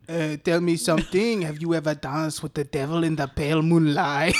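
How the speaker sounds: low put-on French accent. Shown as a demonstration. Podcaster demonstrates the accent on request, French accent. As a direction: show off your movie-line French accent. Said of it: "that's a good French accent".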